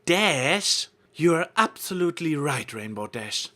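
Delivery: theatrical. A narrator's reading. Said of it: "theatrical but convincing tone".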